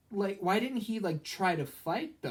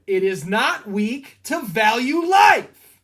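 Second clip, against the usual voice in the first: slow, much louder heroic declaration, pitched up; full-throated quoted war cry; movie-line shout; 4 semitones higher, 10 dB louder, slower than usual. Retold or imitated. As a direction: bellow it like the hero defying the villains. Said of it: "which is what Raiden shouts".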